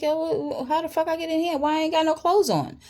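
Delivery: very high, stammering disbelief; retold incredulous questioning. Woman retells asking him how she got here, high-pitched and incredulous. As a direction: demand answers in a high, baffled voice.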